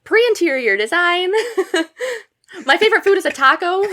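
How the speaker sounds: laughing through a mock self-introduction; giggling mock freshman introduction. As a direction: parody your old eager intro while cracking up. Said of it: "Oh, you have a fully developed sense".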